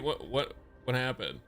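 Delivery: normal talking voice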